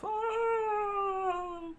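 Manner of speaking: high falling scream. Acted. Brothers shoved overboard, screaming. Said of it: "They screamed as they flew off".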